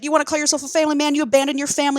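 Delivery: fast, high mock tirade; going in hard. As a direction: tear into him in a retold rush. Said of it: "just fucking going in on him".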